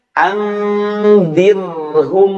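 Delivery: "Andirhum" drawn out slowly; slow Quran recitation; Quranic recitation. Shown as a demonstration. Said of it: "contoh yang lainnya".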